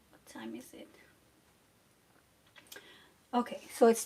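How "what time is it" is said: whispered to herself, unvoiced. Real, not acted. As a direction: breathe the question under your breath.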